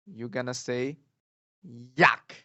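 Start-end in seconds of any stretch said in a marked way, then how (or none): 2.0-2.3 loud abrupt "Yuck!", falling sharply; disgusted fourth tone. Acted. Teacher acts eating bad food, loud falling disgust. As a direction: spit out "Yuck!" in mock disgust. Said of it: "you eat something really bad".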